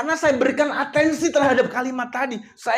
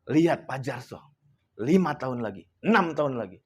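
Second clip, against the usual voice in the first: lower, inward voice of a silent prayer; inner prayer; 9 semitones lower, 4 dB quieter than usual. Retold or imitated. Praying inwardly that belittled Pak Jarso will rise, low inward voice. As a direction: pray it to yourself, pitched low. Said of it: "saya dalam hati aja membatin".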